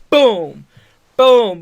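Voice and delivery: shouted booming chair hits; loud mock crash noises; loud chair impact. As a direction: bark the impact sounds out loud. Imitating chair shots.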